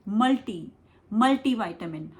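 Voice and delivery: मल्टी with an "ee" ending; Indian pronunciation. Shown as a demonstration. Teacher showing Indian usage, मल्टी said "MUL-tee". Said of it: "हम India में कैसे बोलते हैं".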